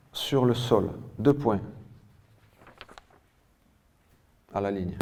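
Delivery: phrase-by-phrase classroom dictation, punctuation spoken; projected, deliberate teacher-style reading. Real, not acted. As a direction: dictate clearly, leaving long gaps between phrases. Reads out a dictation.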